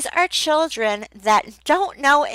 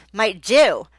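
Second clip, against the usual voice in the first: ordinary talk, nothing marked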